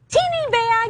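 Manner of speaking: put-on high tiny voice for teeny bag; squeaky little voice. Shown as a demonstration. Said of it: "in your little voice".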